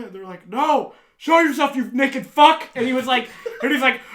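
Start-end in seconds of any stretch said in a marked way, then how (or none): 0.5-2.7 loud, high jeering demand; slow, shouted taunt; mock crowd yell. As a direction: imitate friends heckling someone out of hiding. Crowd yells at hiding friend.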